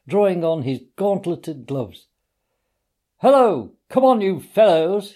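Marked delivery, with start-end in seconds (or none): 3.2-5.1 much higher, louder cheery hail; cheerful cry. A narrator's reading. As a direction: call out to pals from the steps. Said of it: "he cried cheerfully".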